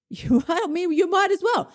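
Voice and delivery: high-pitched comic insistence; mock justification. Inner voice urging dieter to go all in, high mock-reasonable urging. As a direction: pitch it way up, egging on.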